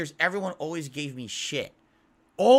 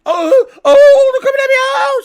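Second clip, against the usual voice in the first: shrieking far higher and much louder; mock screaming; 20 dB louder than usual. Mocking obnoxious Let's Players, shrill mock screaming. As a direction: scream like a panicking streamer.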